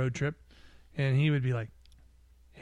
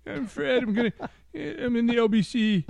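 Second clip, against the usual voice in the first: much higher, louder hammed-up goofy guy; mock Fred voice; 9 semitones higher, 6 dB louder than usual. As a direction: ham up a silly impression of a friend, laughing at the end. Mimics Fred from last episode.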